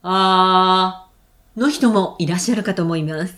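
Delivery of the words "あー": a low, sustained ah from the chest. Shown as a demonstration. Teacher showing a low voice type, low ah. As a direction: show the low, heavy version of the ah. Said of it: "の人もいれば".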